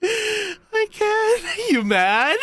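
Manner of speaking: squeaky high cackling taunt; can't hold it together, laughing through the words; laughing too hard to taunt properly; laughing fit. Real, not acted. Streamer cracking up at own taunt.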